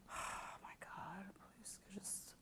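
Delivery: breathy whispered plea to God. Acted. Mother pleading to herself in the coffee line, whispered. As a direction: whisper it under your breath.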